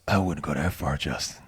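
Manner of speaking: Dark Knight voice. Pretend. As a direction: drop into a deep caped-crusader voice. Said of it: "I just wanna use my Dark Knight voice".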